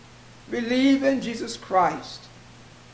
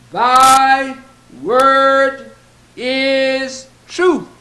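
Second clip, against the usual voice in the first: preaching emphasis; 2 semitones higher, 6 dB louder, much slower than usual. Acted. Preacher proclaims scripture quote.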